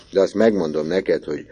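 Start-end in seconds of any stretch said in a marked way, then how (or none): none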